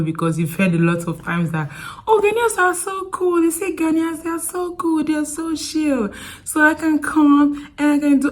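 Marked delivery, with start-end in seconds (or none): 2.1-8.3 high, light mock-naive voice; gushing visitor imitation. Vlogger mimicking diasporans who heard Ghanaians are chill, mock gushing.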